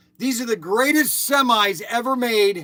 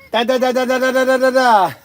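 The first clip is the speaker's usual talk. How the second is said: gun noises; 5 semitones higher, 7 dB louder, faster than usual. Retold or imitated. Mimics toy gun fire again.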